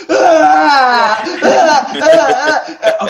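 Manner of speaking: loud, high fake bawling in repeated bursts; mock sobbing wail. Dad taking his turn at crying, mock wailing. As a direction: bawl like a child, hammed up. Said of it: "the two girls are crying, sobbing".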